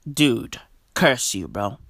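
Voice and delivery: deep growled curse; low curse. Acted. Low cursing at burper.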